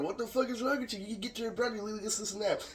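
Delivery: lightly hammed scolding woman; mock angry Akira. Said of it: "She said".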